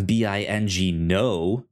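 usual speaking voice

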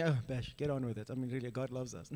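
mock the impatient listener lightly. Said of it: "some people might be here".

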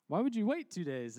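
mock disbelief. Pastor reacting to Jesus waiting two days, mock disbelief.

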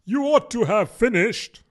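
a hint of a petulant king; king rebuking. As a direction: scold the Hatter royally.

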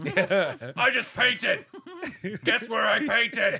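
lurching through it in mock outrage while laughing; mock angry. Quoting his own angry photo post, mock angry and laughing. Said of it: "You're looking, like, very angry".

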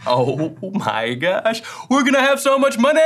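hammed-up money-grabbing excitement; mock greedy glee. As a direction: pretend to be thrilled about the cash. Mock greedy reaction, then kidding.

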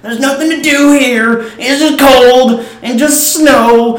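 high, loud, slow mock whine; whiny rant. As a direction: ham up a pathetic complainer. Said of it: "has this rant where he's like".

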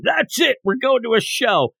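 high, decisive burst of enthusiasm; fired up. Acted. Lloyd decides they go to a show, fired up. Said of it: "fired up to have fun".

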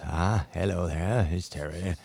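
softly voiced, unhurried veteran broadcaster impression; Terry Wogan. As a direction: drop low and ease gently into the hello. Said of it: "And he said in his voice".

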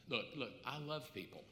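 low, hushed self-justifying aside; quiet, low protest; sheepish excuse. Acted. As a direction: back away softly from the request, acted. Acts out sheepish excuse to God.

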